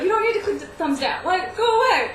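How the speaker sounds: loud, high, laughing exasperation; loud laughing rant. Real, not acted. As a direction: shout it out while laughing.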